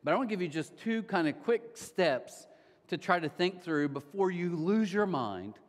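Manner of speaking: ordinary talk, nothing marked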